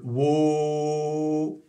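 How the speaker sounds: "wo" held very long on a steady O; long sustained "wo"; drawn-out French O. Shown as a demonstration. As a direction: stretch "wo" out on a French O. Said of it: "Vous partez du O français".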